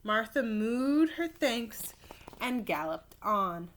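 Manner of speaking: usual speaking voice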